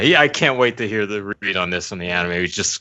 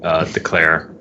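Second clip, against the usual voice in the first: usual speaking voice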